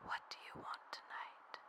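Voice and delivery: intimate whisper in the ear; hushed, teasing question. Acted. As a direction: whisper it softly, close to the listener. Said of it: "moved back up to whisper in his ear".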